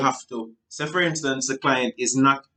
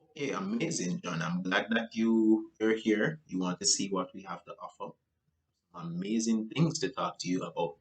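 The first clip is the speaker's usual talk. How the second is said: softer, steady reply; gently matched tone; 4 semitones lower, 8 dB quieter than usual. Shown as a demonstration. Salesperson matching John's calm tone, softly. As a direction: meet him at his level. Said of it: "if you realize with my voice".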